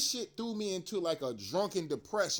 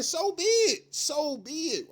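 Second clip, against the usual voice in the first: defiant outburst; 9 semitones higher, 6 dB louder, slower than usual. Real, not acted. Defiant rant about dying.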